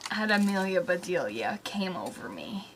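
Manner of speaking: breathy weary whisper; tired whisper. Real, not acted. Reading aloud, overwhelmed by the story, tired whisper. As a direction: whisper it, worn out by the book. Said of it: "the feeling of utter tiredness".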